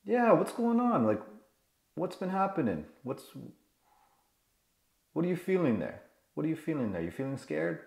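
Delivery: put-on soft, tender checking in on a child; compassionate. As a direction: gently coax out the feeling. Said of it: "compassion, number one".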